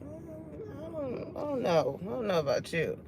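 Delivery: soft, hesitant and noncommittal; quiet, stop-and-start doubt; puzzled mumble. Pretend. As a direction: mumble it like you're scratching your head. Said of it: "You just be like".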